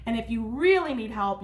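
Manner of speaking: ordinary delivery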